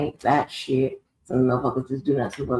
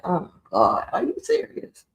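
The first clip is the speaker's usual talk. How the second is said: are you serious squealed very high; mock-shocked, voice shooting up on serious; very high-pitched oh my God are you serious; shocked disbelief; 3 semitones higher than usual. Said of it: "I'm like".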